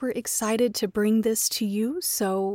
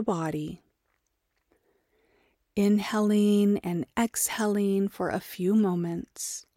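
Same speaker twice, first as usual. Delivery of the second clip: guided meditation. Acted. Teacher leading listeners into Yoga Nidra, guided meditation. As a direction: guide them gently into rest, leaving space for breath.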